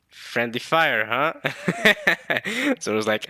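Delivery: ordinary delivery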